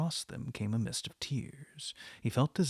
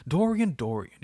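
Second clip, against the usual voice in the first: louder pleading cry of the name; cried out; 5 semitones higher, 9 dB louder than usual. A narrator's reading. Painter crying out to Dorian, catching his hand, loud plea. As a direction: call him back in alarm. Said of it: "he cried".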